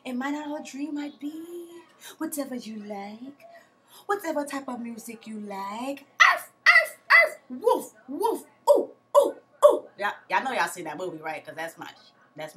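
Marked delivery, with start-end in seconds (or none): 0.0-6.0 lightly voiced soft movie line, quieter; a hint of the seductive bride from the film; imitating movie character. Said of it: "Y'all know the one that was like". 6.3-9.8 high, loud barking and howling calls; imitated animal calls. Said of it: "Y'all know the one that was like".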